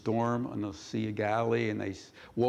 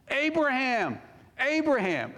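urgent calling out; 12 semitones higher than usual. Acted. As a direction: call it out to stop someone, pitched high. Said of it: "is that how he said it".

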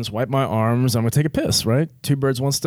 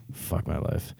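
breathy, hopeless whisper under the breath; whispered despair; 7 semitones lower, 11 dB quieter, slower than usual. Retold or imitated. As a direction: whisper it to yourself, defeated. Despair muttered to himself.

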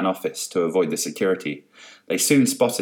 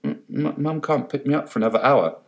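stuttering, mildly scared; terrified stammer; 6 semitones higher than usual. A narrator's reading. Student scared of Carol, stuttering mild fear. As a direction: stammer it out nervously. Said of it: "He looked terrified".

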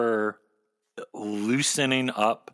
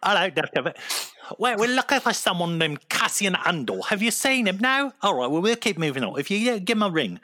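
high, hammed-up British accent; a jokey British officer questioning a Death Trooper; bad British accent; 8 semitones higher, faster than usual. Host plays a Brit asking a Death Trooper about Cassian, in a bad British accent. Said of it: "horrible, horrible accent".

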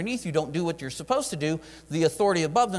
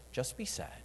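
much softer, hushed reply of God; gentle divine voice; 2 semitones lower, 12 dB quieter than usual. Acted. The Lord quietly telling Nehemiah just to be sad. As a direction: say it softly, like God calmly insisting.

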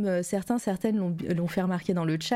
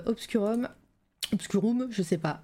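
ordinary talk

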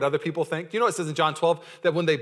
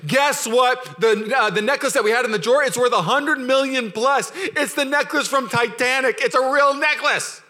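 loud, higher, slow excited calling; excited yelling; 7 semitones higher, 5 dB louder, slower than usual. Retold or imitated. Calling the whole family, yelling the news higher and louder. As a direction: yell the big news to family. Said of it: "You'd be calling your whole family".

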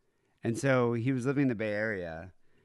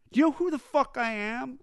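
mock-drunk yell pushed much higher; shrill drunken rage; 13 semitones higher, 3 dB louder than usual. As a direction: screech it, outraged nobody knows him. Drunk rocker rants at bartender.

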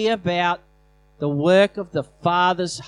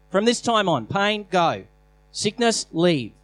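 raised, forceful command to sickness; firm command; 3 semitones higher than usual. Shown as a demonstration. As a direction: order the pain out with authority. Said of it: "it's a sign of saying".

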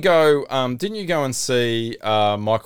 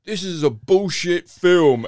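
retold heckle; 7 semitones higher, 4 dB louder, slower than usual. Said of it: "turned around to the whole audience and go".

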